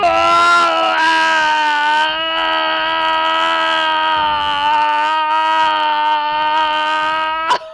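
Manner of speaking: one drawn-out, sustained bellow; long loud roar. Acted. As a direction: hold a huge roar for several seconds. Tiger roars on arriving.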